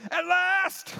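high, excited outburst of joy; delighted cry. Acted. Adam greeting the woman, high and overjoyed. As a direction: shout it like you finally found her.

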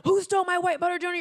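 loud, high mock-furious demand; so angry. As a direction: fire it off in comic rage. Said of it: "so angry".

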